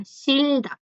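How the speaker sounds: "Shilda" carefully stretched, falling at the end; silent consonant demo. Shown as a demonstration. Teacher proves the rule to students, careful pronunciation demo. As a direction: model "Shilda" with the batchim left silent. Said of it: "Por eso no suena".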